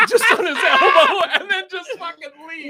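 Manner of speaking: high, loud laughing speech; helpless laughter. Real, not acted. Storyteller cracking up over the elbow handshake, laughing loudly. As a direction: squeal the punchline through laughter.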